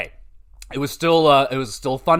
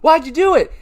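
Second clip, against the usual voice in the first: reporters demanding; 10 semitones higher than usual. Retold or imitated. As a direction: beg for answers like the press. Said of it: "everyone's expecting answers".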